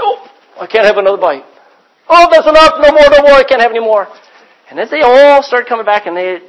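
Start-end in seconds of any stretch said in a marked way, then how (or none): 0.0-4.1 mock full protest. Crowd stuffed with food.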